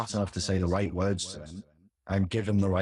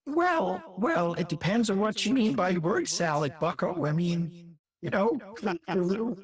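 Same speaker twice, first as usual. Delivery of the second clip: much higher put-on lecturing voice; mock Peterson reply; 10 semitones higher than usual. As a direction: answer back in his high pedantic voice. Answering in the same voice.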